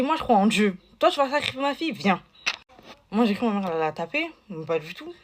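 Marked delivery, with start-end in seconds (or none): 0.0-2.2 defiant outburst. Retold or imitated. Mother confronts the other mother.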